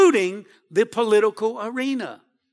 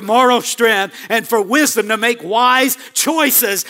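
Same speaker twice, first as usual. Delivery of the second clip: "moral strength and for wisdom" louder, slow and half-sung, stress on "wisdom"; louder repeat; 6 dB louder, slower than usual. Shown as a demonstration. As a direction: say it again so they finally hear it. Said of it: "I don't think you heard me".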